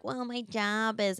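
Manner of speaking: slow, soft whiny guy. Retold or imitated. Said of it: "he even had a whiny voice".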